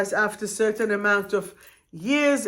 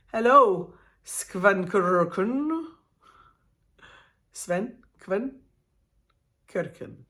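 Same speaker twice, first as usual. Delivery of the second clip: mock Swedish accent; 2 semitones lower, slower than usual. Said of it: "say everything in a Swedish accent".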